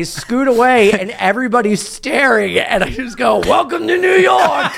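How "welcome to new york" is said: high triumphant shout; high-pitched hammed-up proclamation; retold street holler. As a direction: holler it to the gawking onlookers. Retold holler to staring crowd.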